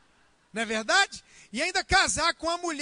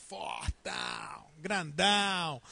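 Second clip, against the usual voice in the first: much lower, slow hammed-up "fortão"; mock macho voice; 7 semitones lower, 6 dB quieter, much slower than usual. Pastor mimics a wife dreaming of a Schwarzenegger, deep and slow. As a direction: puff up like a bodybuilder. Mocking wish for muscled man.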